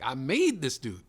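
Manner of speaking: slow, emphatic proud brag; proud boast. Retold or imitated. New dad bragging to his homegirls, slow proud boast. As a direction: stretch out I made with pride. Proud texting reply to friends.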